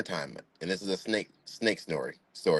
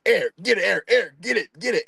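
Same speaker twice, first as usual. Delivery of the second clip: high, frantic mock panic; imitating a scared dad, high; panicked dad; 9 semitones higher than usual. Dad beating mulch, panicking.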